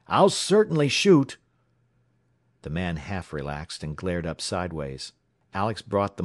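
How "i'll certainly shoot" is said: high, loud warning; cried threat. A narrator's reading. Alex warns the man pinning the oiler, crying out. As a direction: cry out the threat in earnest. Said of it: "he cried".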